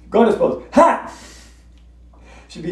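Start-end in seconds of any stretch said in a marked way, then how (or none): 0.7-1.1 high, loud sharp ha. Retold or imitated. Yoga teacher Fern releasing her chi, loud ha. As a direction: shout it out like a release. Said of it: "she'd go".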